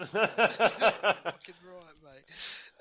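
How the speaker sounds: stretched slowly, loud and laughing; drawn-out laughing echo. Real, not acted. Echoes friend's laughing exclamation.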